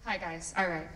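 usual speaking voice

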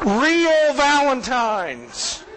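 slow, laughing through the words. Real, not acted. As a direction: stretch it out while laughing. Punchline after joke card.

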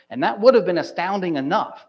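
usual speaking voice

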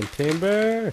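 high, stretched call of Timber; lumberjack yell. Pretend. Lumberjack warning the forest as the tree falls, hammed-up yell. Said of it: "And I'm yelling".

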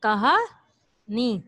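कहानी stretched very slowly, each syllable drawn out; teacher drawing out कहानी so children hear its parts. Shown as a demonstration. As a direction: spell कहानी out slowly for the class. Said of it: "इसमें दो अरकान है".

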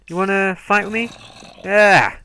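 high, loud challenge; taunting shout. Real, not acted. Gamer taunts an attacking mob, shouting high. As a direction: yell the dare at the mob.

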